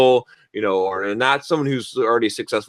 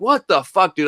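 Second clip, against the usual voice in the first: pissed; 8 semitones higher than usual. Retold or imitated. Cursing at the customer on the phone, angry. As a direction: spit it out at him, fed up. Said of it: "I was pissed".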